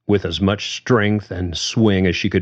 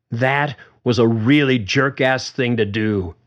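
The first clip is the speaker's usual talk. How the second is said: angry; 5 semitones higher than usual. A narrator's reading. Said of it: "Susan was angry now".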